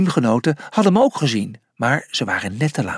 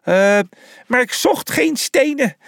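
protesting; 10 semitones higher than usual. A narrator's reading. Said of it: "protesteerde de antilope".

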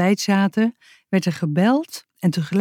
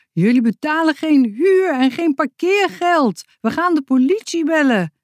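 shrill woman; 10 semitones higher than usual. Acted. Angry neighbour woman complaining at the door, shrill. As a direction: screech it like an outraged neighbour. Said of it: "met een schelle stem".

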